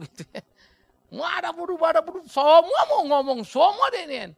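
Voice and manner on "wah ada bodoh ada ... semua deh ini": high, loud caricature of a chatterer; exaggerated high-pitched mock rant; mocking a blabbermouth. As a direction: imitate someone who talks about everything. Imitating a friend's nonstop mouth.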